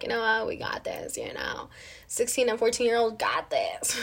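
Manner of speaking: voiceless conspiratorial whisper; hushed, smug little whisper. Retold or imitated. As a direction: breathe it like a secret pact between cousins. Teens whispering bold confidence.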